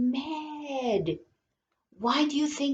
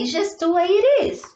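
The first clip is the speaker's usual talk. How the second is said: higher and a little fast, sing-song; playful high repeat; 7 semitones higher, 3 dB louder, faster than usual. Shown as a demonstration. Teacher to children, echoing herself higher and playful. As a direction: bounce it back up high and quick.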